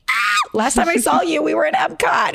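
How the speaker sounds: high, gushing mock squeal of recognition; excited reunion greeting. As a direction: act out spotting a long-lost friend. Acted greeting of an old colleague.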